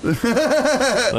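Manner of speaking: slow, amused drawl; drawn-out laughing aside. Real, not acted. Friends laughing over spam replies, slow amused drawl. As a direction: stretch it out slowly, fighting a laugh.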